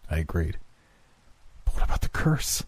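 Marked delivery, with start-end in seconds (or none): 1.7-2.6 high, whispered dread; frightened. A narrator's reading. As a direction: breathe it out in fear. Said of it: "Henry retorted".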